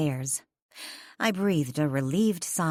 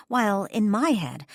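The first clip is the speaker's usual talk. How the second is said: plain reading voice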